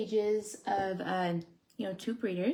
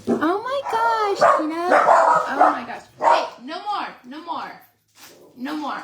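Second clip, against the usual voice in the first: exasperated scolding; 9 semitones higher, 8 dB louder than usual. Real, not acted. Owner loses patience with barking dogs, loud exasperated scold.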